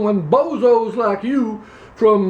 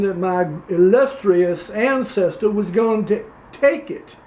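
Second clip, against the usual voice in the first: put-on Louisiana accent. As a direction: play a pompous Louisiana candidate, Southern accent. Same character continues.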